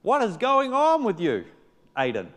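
high, loud exasperated rebuke to himself; mock-exasperated, loud and high; frustrated self-scolding. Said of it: "I found myself frustrated".

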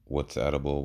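normal talking voice

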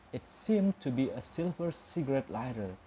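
ordinary delivery

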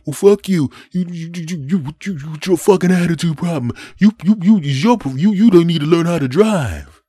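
high, loud sputtering outrage; imitated angry driver, high-pitched; cursing driver. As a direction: stammer furiously like a flustered road-rager. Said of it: "begins cursing at me".

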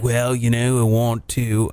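low attempt at an unplaceable accent; [name]. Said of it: "what fucking accent I'm doing".

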